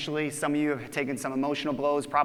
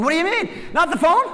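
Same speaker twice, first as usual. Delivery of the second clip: high, louder mock panic at the news; mock shocked disbelief; 10 semitones higher, 6 dB louder than usual. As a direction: play the stunned staff member, pitched way up. Team reacts to new contact ways.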